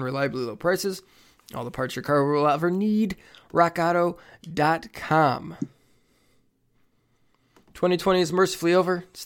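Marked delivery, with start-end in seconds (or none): 3.5-5.6 drawn-out ad tagline. Acted. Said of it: "ad sign-off".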